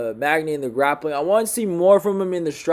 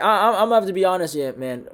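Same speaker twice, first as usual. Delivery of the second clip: ordinary delivery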